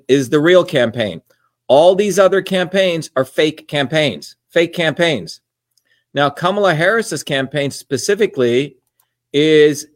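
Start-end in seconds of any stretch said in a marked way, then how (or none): none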